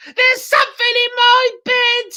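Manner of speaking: high panicked shriek; screaming. Acted. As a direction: shriek in terror. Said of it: "screamed Missus Twit".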